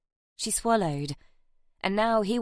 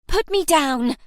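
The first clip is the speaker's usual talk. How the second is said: loud, higher demand; insisting; 8 semitones higher, 8 dB louder than usual. Acted. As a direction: yell it while kicking. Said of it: "Nell insisted".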